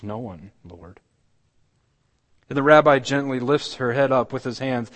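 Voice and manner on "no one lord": soft, tearful "No one, Lord."; through her tears. A narrator's reading. A weeping woman answers the rabbi, softly tearful. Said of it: "And through her tears she answers".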